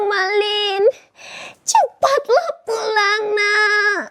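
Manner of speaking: put-on old mother weeping and calling for her son; lamenting old mother. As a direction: cry out for him with longing. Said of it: "Begitulah rintihan Monde Rubaya".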